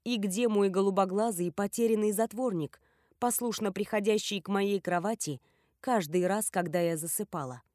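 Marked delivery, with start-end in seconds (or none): none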